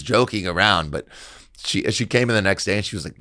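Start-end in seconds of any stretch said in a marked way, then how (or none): none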